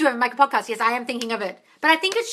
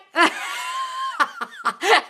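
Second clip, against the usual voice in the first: high burst of laughter. Real, not acted. Laughing at her own parody.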